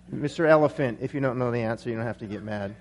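trailing off low and very soft; dropping out. Acted. Dazed lion answering the elephant, voice dropping out. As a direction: let the punchline sink away almost to nothing. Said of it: "kinda dropped out there".

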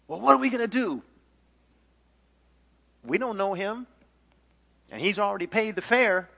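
high, loud fretting; panicked sailor. Acted. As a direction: argue anxiously with your shipmates. Sailors panic over what to do.

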